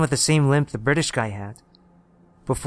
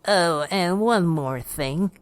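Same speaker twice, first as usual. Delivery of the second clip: put-on high, slow old-woman voice; old lady; 4 semitones higher, much slower than usual. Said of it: "She nodded and continued".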